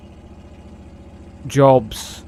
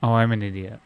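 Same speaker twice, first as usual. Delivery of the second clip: ordinary talk, nothing marked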